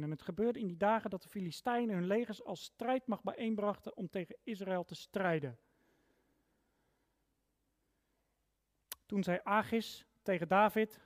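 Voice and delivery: reading the Bible aloud to the congregation, measured and clear; scripture reading. Acted. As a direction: give the text weight, line by line. Reads the Bible passage aloud. (plechtig voorgelezen bijbeltekst)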